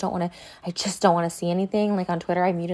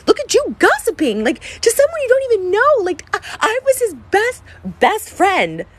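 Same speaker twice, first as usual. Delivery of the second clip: higher, voice cracking with crying; real crying, voice breaking; breaking into tears; 11 semitones higher, 4 dB louder than usual. Real, not acted. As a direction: fight through the tears as it hits you. Hurt by friend's betrayal.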